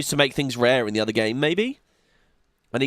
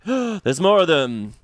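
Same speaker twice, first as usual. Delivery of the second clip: slow alarmed soldier; 4 semitones higher, 3 dB louder, slower than usual. Acted. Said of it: "And they're like".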